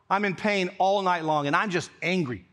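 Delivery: usual speaking voice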